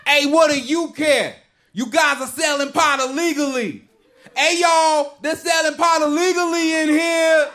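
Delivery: mock the angry customer yelling at the clerks. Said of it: "He just starts yelling".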